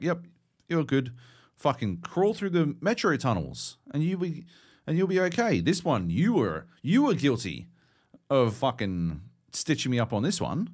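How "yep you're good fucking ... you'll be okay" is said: breezy game voice. Retold or imitated. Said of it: "It'd be like".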